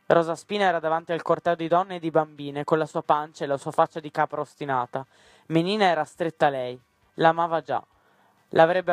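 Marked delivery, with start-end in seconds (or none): none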